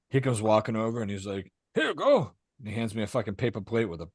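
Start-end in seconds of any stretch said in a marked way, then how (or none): 1.7-2.3 cheerful neighbor voice. Retold or imitated. Jose handing steak.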